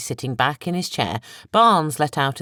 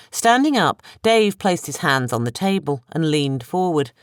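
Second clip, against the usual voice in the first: ordinary delivery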